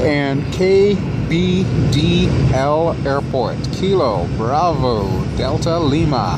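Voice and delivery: hammed-up flight-deck arrival announcement; mock cabin announcement. Pilot-style arrival announcement.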